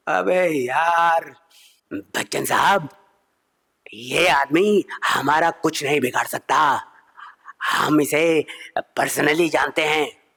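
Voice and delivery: slow it right down like Big B; Bachchan impression. Bachchan voice defending comedian.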